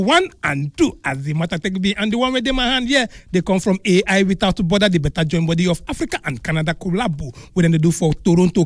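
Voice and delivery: lively pidgin promo voice; upbeat sponsor announcement; radio ad read. Acted. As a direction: sell it to the listeners.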